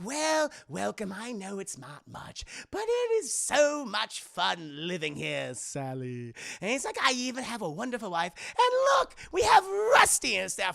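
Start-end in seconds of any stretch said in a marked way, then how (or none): 0.0-6.3 slow, drawn-out theatrical villain; hammed-up cartoon villain welcome; Joker. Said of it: "And Joker's like". 7.0-10.4 high, loud showman villain; excited cartoon villain; Joker. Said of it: "And Joker's like".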